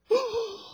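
sharp high gasp of surprise; shocked gasp. Retold or imitated. Crowd reacting as the boy says the king is naked, mock gasp.

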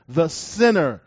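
"the sinner" pressed down with a falling tune; emphasis on sinner. Shown as a demonstration. Preacher reading scripture, emphasising "the sinner". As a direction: set "the sinner" apart with weight. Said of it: "that's my emphasis".

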